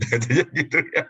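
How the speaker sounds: laughing through the words. Real, not acted. Preacher telling the congregation about the chicken, laughing. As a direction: let the laugh spill into the line.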